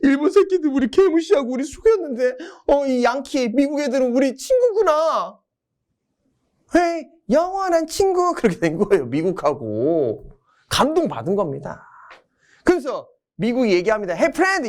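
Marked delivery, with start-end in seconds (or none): 0.0-8.3 high, hammed-up sobbing gratitude; overplayed weepy voice; mock tearful. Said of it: "눈물 흘리는 거죠". 14.1-14.7 high mock-buddy call; put-on chummy greeting; friendly hail. 미국이 친구라고 부름.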